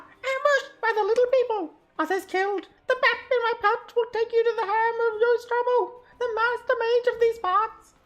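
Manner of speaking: very high, loud, urgent dwarf voice; a dying dwarf begging his rescuer, high and strained; pleading dwarf. Acted. Dying dwarf begging the player to deliver the hammer.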